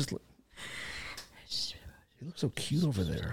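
soft whisper, nearly breath. Real, not acted. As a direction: whisper fondly about the sleeper. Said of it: "that's why we're talking quiet".

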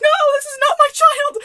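high, loud mock shout; hammed-up exasperated yelling; frantic protest. Imagined protest at staring shoppers.

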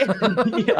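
high laughing yeahs. Real, not acted. Laughs at joke.

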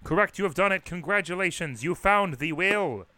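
loud, deliberate announcement; mock-official congratulations to a caller; proclaimed greeting. Shown as a demonstration. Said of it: "answered the phone this way".

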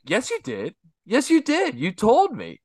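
put-on high insistent kid voice; whiny kid insisting. Uncle retelling the nephew's argument, high and whiny. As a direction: keep pushing back like a stubborn kid. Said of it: "he's like".

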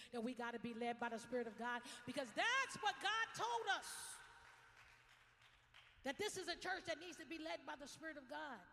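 rising loud preacher's cry; preaching climax. Acted. Pastor rousing the church, loud and high. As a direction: lift it up to the rafters.

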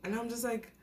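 ordinary delivery

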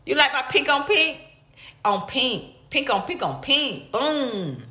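ordinary talk

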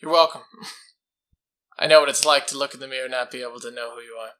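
fast, loud in-character reply. Acted. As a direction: answer fast as the hero. Player voices character reply.

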